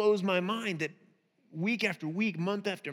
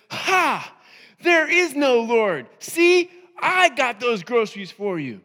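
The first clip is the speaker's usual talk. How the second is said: loud triumphant taunt; gloating shout; 3 semitones higher, 10 dB louder, slower than usual. Retold or imitated. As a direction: jump out and crow at her, loud. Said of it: "he says".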